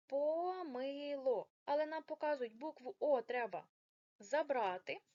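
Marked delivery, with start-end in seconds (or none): 0.1-1.5 помило stretched very slowly; drawn out. Shown as a demonstration. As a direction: sound out помило syllable by syllable. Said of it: "виходить".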